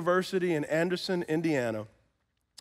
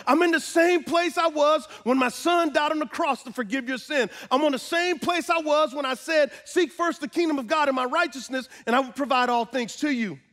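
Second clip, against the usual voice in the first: God's emphatic answer; 10 semitones higher, 3 dB louder than usual. Retold or imitated. Voices God's answer in sermon.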